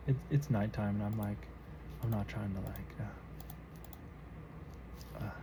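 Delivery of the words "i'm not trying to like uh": usual speaking voice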